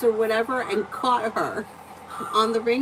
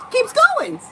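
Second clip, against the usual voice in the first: pitched way up and louder in disbelief; disbelieving exclamation; 7 dB louder than usual. Retold or imitated. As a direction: cry out as he drives past. Husband drives past without stopping.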